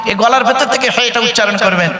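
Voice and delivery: usual speaking voice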